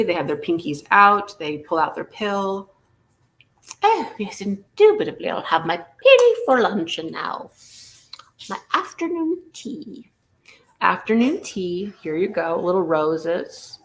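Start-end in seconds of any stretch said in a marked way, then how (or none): 3.8-10.1 fancy lady impression. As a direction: play an affected high-society tea lady. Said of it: "That's how fancy ladies do it".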